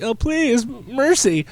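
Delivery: high-pitched begging for mercy; mock pleading. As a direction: beg in a hammed-up high voice. Acts out beaten kid begging.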